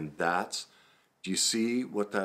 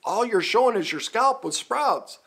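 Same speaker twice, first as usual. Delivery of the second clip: louder mock reality check; blunt self-talk; 5 dB louder than usual. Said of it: "I see pictures, I'm like".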